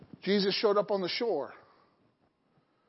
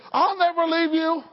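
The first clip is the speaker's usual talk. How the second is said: loud bold vow; 6 semitones higher, 5 dB louder than usual. Retold or imitated. Peter before the disciples.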